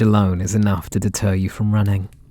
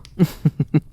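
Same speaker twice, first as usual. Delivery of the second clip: short laugh; 5 semitones higher than usual. Acted. Captor laughs after joke.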